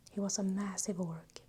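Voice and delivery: ordinary delivery, nothing marked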